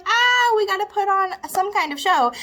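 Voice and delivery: loud, high jolt of alarm; sudden panicked realization. Acted. Acts out sudden waking realisation.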